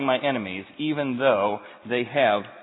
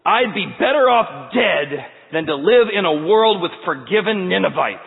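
loud, higher, bitter outcry; bitter Jonah; 5 semitones higher, 9 dB louder than usual. Acted. Preacher voicing Jonah's bitter outcry to God, loud and higher. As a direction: spit it out like a man who'd rather die. Jonah voiced wishing death.

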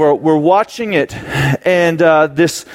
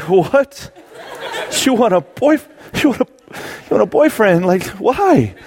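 higher-pitched, halting disbelief; shocked dad; 5 semitones higher than usual. Retold or imitated. Father hearing his small daughter's wish, mock shock. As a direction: stammer it out like a stunned parent.

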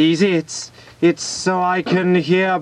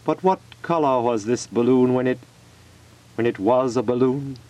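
sad; 6 semitones lower, 3 dB quieter than usual. Acted. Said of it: "he said to himself sadly".

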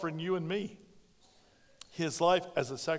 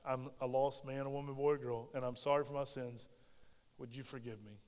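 lower, much softer plea to God; humble prayer; 6 semitones lower, 7 dB quieter than usual. Acted. Sinner asks the Lord for forgiveness, soft and humble. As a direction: pray it small and humble. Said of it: "stick your hand out and say".

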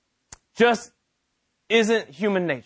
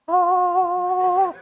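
high startled yelp; a sudden frightened gasp; 11 semitones higher than usual. Retold or imitated. John startled as Gabriel lands, high frightened gasp.

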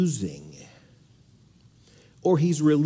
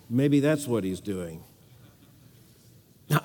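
ordinary talk, nothing marked

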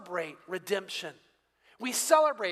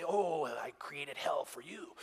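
slow, lower, whispered threat; mock menace; 7 semitones lower, 6 dB quieter, slower than usual. Pastor mocking an angry God who condemns sinners, slow whispered menace. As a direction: hiss it as a sinister mock condemnation.

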